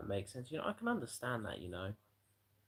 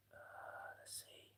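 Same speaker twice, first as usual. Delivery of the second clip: whisper; 15 dB quieter than usual. Real, not acted. Searching for a video.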